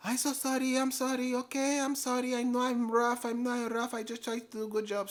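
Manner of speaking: high-pitched imitation of an over-apologetic doctor; frantic apologizing. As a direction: plead sorry over and over in a high put-on voice. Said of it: "apologizing like crazy".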